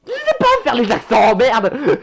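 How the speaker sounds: exasperated shout, swearing at the end; exasperated outburst. Real, not acted. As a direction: blurt it out, fed up with yourself. Exasperated at failing the accent.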